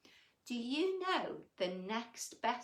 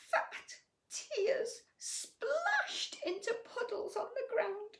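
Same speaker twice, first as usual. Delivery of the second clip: high, slow, weepy narration; tearful reading; 11 semitones higher, slower than usual. A narrator's reading. Reading a sad monster story to children, weepy. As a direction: draw out the tears slowly.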